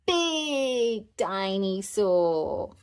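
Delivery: put-on very slow, drawn-out babyish "Big dinosaur"; toddler voice. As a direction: stretch it out as big as the arms. George, arms held wide.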